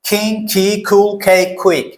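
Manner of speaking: কিং, কি, কুল, কে, কুইক with K like খ; aspirated K drill. Shown as a demonstration. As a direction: drill each word with a puffed K. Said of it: "এটা উচ্চারণ করবো আমরা খ এর মতো".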